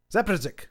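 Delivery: Zapresic as "ZAP-ress-ick", falling; trial pronunciation. Shown as a demonstration. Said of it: "out of those seventeen pronunciations".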